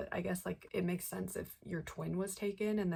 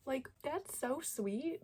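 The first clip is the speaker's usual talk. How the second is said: gushing; 8 semitones higher, slower than usual. Real, not acted. Gushing over sweet brother scene.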